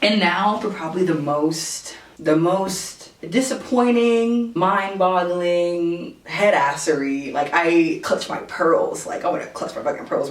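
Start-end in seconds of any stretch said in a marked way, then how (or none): 2.2-7.3 very slow dramatic drawl; drawn out. Real, not acted. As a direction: stretch every word out. Building up the next story.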